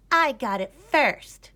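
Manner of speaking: put-on high, loud little girl cry; high loud squabbling kid; cried out. A narrator's reading. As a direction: squeal it like a bratty little sister. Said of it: "cried Greta".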